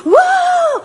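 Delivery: high, drawn-out stadium whoop; crowd shout. Acted. Preacher acting out fans after a goal, shouting. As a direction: let out a long high cheer like a football fan. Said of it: "otros están gritando".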